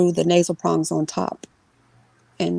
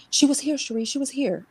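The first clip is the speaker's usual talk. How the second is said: much higher, pleading mother voice; scared mother insisting to her daughter; insistent mother; 8 semitones higher, 3 dB quieter than usual. Retold or imitated. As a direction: press her to believe it.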